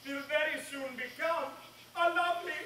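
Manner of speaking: soft, high gloating verse; high, hushed witch's promise; rising incantation. Acted. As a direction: let the spell climb gleefully. Spell rises to its promise.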